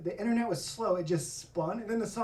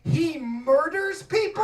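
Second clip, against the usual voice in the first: slow, loud, high mock alarm; slowed and pitched up in fake horror; mock shock; 9 semitones higher, 7 dB louder, slower than usual. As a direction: act stunned by the news. Shocked reveal in the story.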